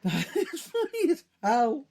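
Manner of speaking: high voice breaking into laughter; laughing hard. Real, not acted. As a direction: say it through real, helpless laughter. Helpless laughing fit.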